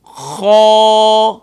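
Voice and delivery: خو with the vowel held open and long, no stop at the end; tajweed demo. Shown as a demonstration. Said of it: "second word ہے خو".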